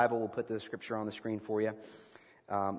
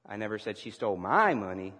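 "my" lifted and punched; stress on my; 3 semitones lower than usual. Shown as a demonstration. Preacher practicing emphasis with the congregation, stress on "my". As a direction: put all the weight on "my". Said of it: "depending on the emphasis that you place".

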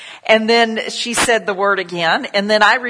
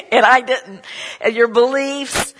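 laughing; 5 semitones higher than usual. Real, not acted. Laughing at the bad word story.

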